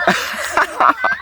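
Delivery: high-pitched giggle; laugh. Real, not acted. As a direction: burst of high staccato laughter. Laughs after very amazing.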